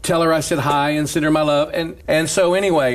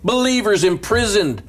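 higher, climbing preacher's voice; building sermon fervor; 9 semitones higher than usual. Acted. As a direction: build to the climax of the point. Building up to his sermon point.

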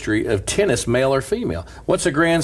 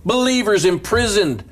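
raised, ringing sermon voice; preaching; 6 semitones higher than usual. Acted. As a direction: preach it out to the church with fervour. Preaching at full force.

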